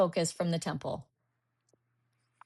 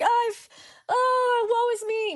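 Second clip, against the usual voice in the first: high, drawn-out woe-is-me wail; hammed-up whine, slow and high; mock self-pity; 13 semitones higher than usual. Beating herself up on the way to the temple, mock self-pity. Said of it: "I'm beating myself up".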